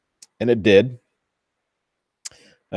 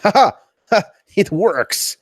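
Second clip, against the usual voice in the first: high, loud mock mad-scientist cackle into "It works!"; mad scientist triumph; 11 semitones higher, 4 dB louder than usual. Maker whose printer probe finally works, hammed-up mad scientist glee. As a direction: crow it like a mad inventor whose creation lives.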